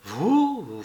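a touch of overwhelmed disbelief; trembling with emotion. A narrator's reading. Patient to the woman at his bedside, moved and astonished. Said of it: "d'une voix tremblante d'émotion".

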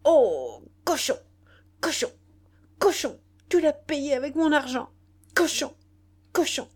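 high, loud, each word spat out; woman hurling insults at her lover. A narrator's reading. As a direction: spit the word like spitting on him. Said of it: "avec le mouvement qu'on fait pour cracher".

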